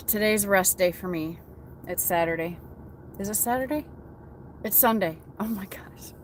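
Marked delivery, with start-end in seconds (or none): 1.8-6.1 a breathy, voiceless aside, half-amused; whispered. Real, not acted. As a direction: whisper "Oh my gosh" to yourself in disbelief. Mutters to self about the day.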